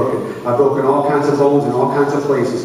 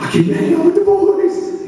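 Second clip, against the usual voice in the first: much higher and eager; 13 semitones higher than usual. Retold or imitated. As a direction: chirp it out full of hope. Eager kid at the door.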